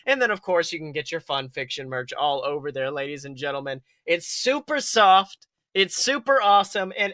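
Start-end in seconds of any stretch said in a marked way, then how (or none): none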